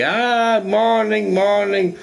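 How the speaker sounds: high-pitched, overjoyed morning greeting; crazy-happy dog. Acted. Happy dog greeting its owner one morning, excited. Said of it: "jumping around like crazy".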